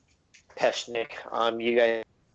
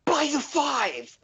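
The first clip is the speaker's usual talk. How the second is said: shocked cry; slower than usual. Acted. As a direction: cry out in horror at the injured friend. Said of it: "the surface gnome was like".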